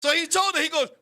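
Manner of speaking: usual speaking voice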